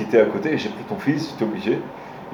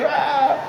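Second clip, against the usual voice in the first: a sudden high mock yelp "ah"; mock outburst. Husband reacting to his wife's words, a high mock yelp. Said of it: "Et lui réagit".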